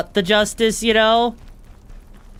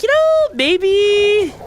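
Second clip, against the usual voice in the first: mocking chat viewer; 9 semitones higher, much slower than usual. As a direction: imitate a confused viewer, high and silly. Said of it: "they would probably be like".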